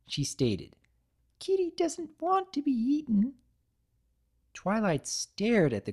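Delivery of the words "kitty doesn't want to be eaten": very high, small creature voice; tiny cookie creature. Acted. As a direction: speak like a tiny living cookie. The cookie pleads.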